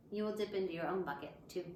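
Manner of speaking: ordinary talk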